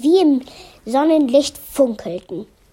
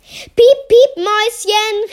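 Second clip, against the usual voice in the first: calling out; 8 semitones higher, 4 dB louder than usual. Acted. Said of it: "rief er".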